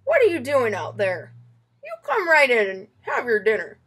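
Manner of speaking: lightly voiced grandpa calling the child in; Poppy's voice. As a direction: call him in from inside, gently bossy. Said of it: "Poppy says".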